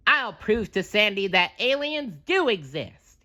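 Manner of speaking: the sponge vowing to prove it; SpongeBob voice. A narrator's reading. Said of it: "he said".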